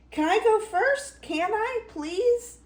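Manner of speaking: put-on high-pitched pleading kid voice; eager little goat. Little goat begging to cross first, eager high plea.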